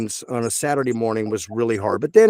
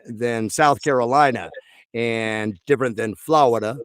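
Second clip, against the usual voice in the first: ordinary delivery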